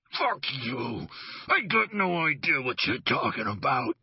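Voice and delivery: a touch of forced snarl through clenched teeth; through gritted teeth. Acted. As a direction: squeeze the words out in pain and spite. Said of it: "through gritted teeth".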